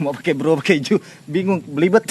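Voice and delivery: high, loud, laughing through it; giggling while joking. Real, not acted. Joking about forms of address. (sambil tertawa)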